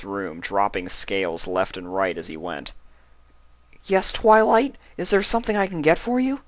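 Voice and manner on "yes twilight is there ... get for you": high, fast, eager little dragon voice; worried young dragon. Acted. Baby dragon answers his sick guardian, high and eager. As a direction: hurry the offer out, young and keen. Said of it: "worried for his friend".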